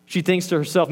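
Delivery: ordinary delivery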